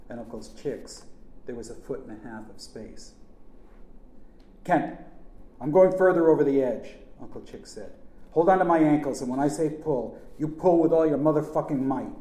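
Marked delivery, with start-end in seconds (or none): 4.6-7.0 loud, raised-pitch warning; urgent call. A narrator's reading. Uncle to nephew on the roof, calling urgently. 8.3-12.1 loud, forceful command; urgent orders. A narrator's reading. Uncle ordering nephew to pull, loud and urgent.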